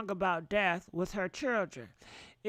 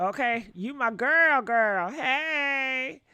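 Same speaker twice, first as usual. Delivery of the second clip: put-on louder, drawn-out "hey" to a girlfriend; playful girlfriend greeting; 2 semitones higher, 5 dB louder, much slower than usual. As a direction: hail your best friend, voice lifting on the hey. Acts out greeting a close friend.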